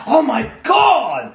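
high, loud wail of despair; imitating a hammed-up anguished wail; mock wailing. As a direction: wail it like a ham actor. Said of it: "like, wailing his".

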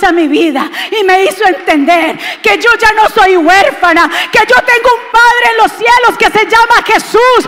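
loud, ringing testimony; preaching at full force. Real, not acted. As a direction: proclaim it to the whole church.

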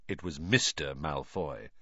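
ordinary delivery, nothing marked

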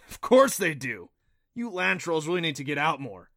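incredulous creature guide; scoffing disbelief. A narrator's reading. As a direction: set them straight, a bit exasperated. Neff scoffs in disbelief at her.

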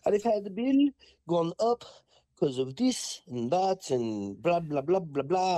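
high, mock whiny customer voice. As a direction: lightly play the complaining lady. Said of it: "She's like".

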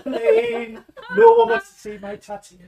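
high, laughing, pleading squeal; laughing plea. Retold or imitated. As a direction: beg through laughter. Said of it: "I was like".

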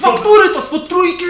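high, loud yelling NPC; shouting. Acted. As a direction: bellow it from the next room. GM voices shouting guest.

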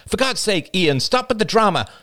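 high, exasperated outburst; fed-up, high-voiced protest. A narrator's reading. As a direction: snap back with pitch way up. Rachel snaps at Ian, pulling away.

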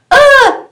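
high sharp mock scream; a sudden playful shriek; short scream. Teller spooking her viewers with a sudden shriek.

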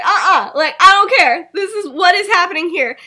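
high, loud indignant refusal; acted yell, brushing him off. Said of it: "they're both yelling".

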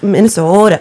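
"Minnesota" with a long, round Minnesotan O; Minnesota accent. Shown as a demonstration. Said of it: "you know, that's kinda how they".

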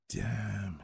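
whispered damn under the breath; muttered thought. A narrator's reading. Man finds broken eggs, whispered curse. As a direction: breathe the curse quietly to yourself. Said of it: "he thought".

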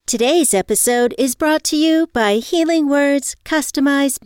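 loud, upbeat sponsor read. Real, not acted. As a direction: sell it like a podcast ad.

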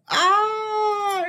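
very high, loud mock scream; imitated falling scream. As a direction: wail like the man hitting the propeller. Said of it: "you hear him screaming".

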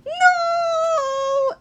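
very high, drawn-out cry; long, hammed-up squeal of despair; mock horrified wail. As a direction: wail it like a doomed little kid. Little kid loses his soul.

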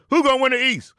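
high, excited preacher's question; hyped question. Acted. The Rev asks about the East, high hyped call.

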